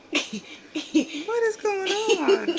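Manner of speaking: slow, drawn-out high disbelief; lightly voiced bewildered mom; baffled. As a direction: stretch it out, stunned. Mom spots naked child.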